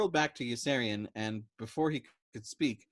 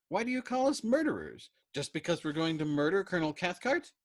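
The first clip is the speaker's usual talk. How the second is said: higher, louder indignant question; affronted; 7 semitones higher, 4 dB louder than usual. A narrator's reading. Dobbs protesting to Yossarian, higher and indignant. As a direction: protest the word. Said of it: "Dobbs was affronted".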